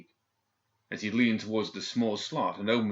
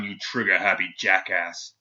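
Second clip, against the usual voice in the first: barked insult; 6 dB louder than usual. A narrator's reading.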